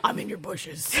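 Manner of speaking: breathy creepy whisper; creepy stalker voice. Acted. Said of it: "creepy stalker voice".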